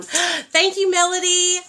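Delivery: high, loud gasp of delight; excited thanks. Real, not acted. Mother delighted as the storm is shown. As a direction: burst out thanking her.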